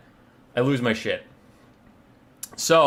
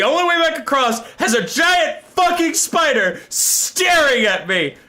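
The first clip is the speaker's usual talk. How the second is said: high, drawn-out alarmed emphasis; exasperated panic; 10 semitones higher, 3 dB louder, slower than usual. Retold or imitated. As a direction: build alarm, stretching the words out. Retelling being trapped by spider.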